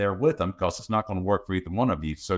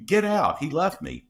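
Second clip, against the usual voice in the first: much higher, mock whiny child; whiny kid imitation; 12 semitones higher than usual. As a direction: parrot a sulky kid who pushed dad away. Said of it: "Kids are like".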